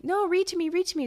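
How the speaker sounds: high-pitched imitation of a pleading kid; demanding child. Parent voicing sick child wanting a story, high and demanding. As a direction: pitch it up like an insistent little one.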